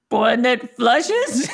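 slow, incredulous laugh on the question; puzzled chuckle; amused deadpan question. Retold or imitated. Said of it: "Now I'm like".

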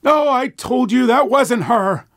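put-on high, slow old man voice. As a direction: correct her like an old husband. Gerald voiced in story.

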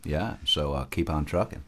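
low, soft McConaughey impression; Matthew McConaughey.

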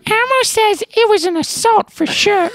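very high squeaky puppet voice; Elmo. Acted. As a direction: pipe it up like a little Muppet witness. Speaks as Elmo in skit.

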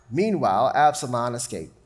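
ordinary talk, nothing marked

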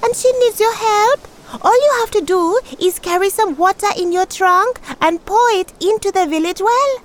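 high little tortoise voice. Acted. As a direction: chirp it up high.

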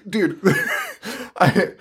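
normal talking voice

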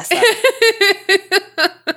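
high, slow, laughing through the words. Pretend. Host cracking up at her own bad accent. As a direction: giggle it out, stretched.

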